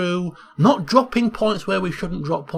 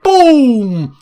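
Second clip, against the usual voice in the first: louder, building to a shouted boom; excited replay; 3 semitones higher, 7 dB louder than usual. Real, not acted. Commentator describing the replay to viewers, louder and thrilled. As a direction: relive the strike with a big punch.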